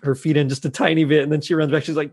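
usual speaking voice

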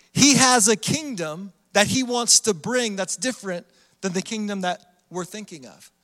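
ordinary talk, nothing marked

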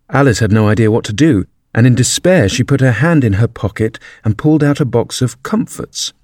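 ordinary delivery, nothing marked

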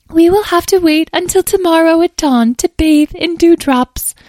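very high, loud fairy voice; dramatic fairy. Acted. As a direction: play a fussy fairy up high.

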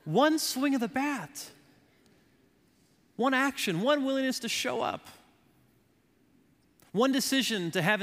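high, driving sermon delivery; full-force preaching. Real, not acted. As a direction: punch out each phrase to rally the congregation.

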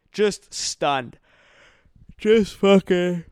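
slow words drawn out by a real yawn; mid-yawn. Real, not acted. Yawning through a late-night Celtics post-game recap. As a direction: let the yawn take over the words. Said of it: "I'm fucking yawning".

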